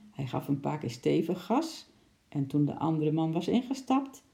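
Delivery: ordinary delivery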